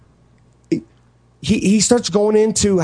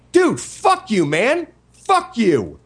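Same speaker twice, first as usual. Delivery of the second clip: higher-pitched retold cursing; snapping back at him, pitched up; angry retort; 7 semitones higher, much slower than usual.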